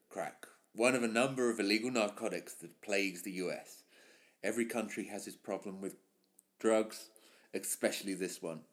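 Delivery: reading the script as the narrator Brandon. Acted. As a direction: read it low and weighty like a cop voiceover. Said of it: "perform this as Brandon".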